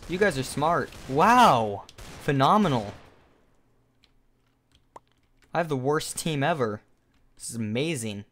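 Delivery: heavily sarcastic praise and dismay; mock your useless team with drawn-out admiration. Real, not acted. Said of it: "hope you can hear the sarcasm in my voice".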